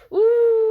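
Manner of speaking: loud high-pitched ooh; high excited whoop. Real, not acted. Gamer reacting to a big play, loud high whoop.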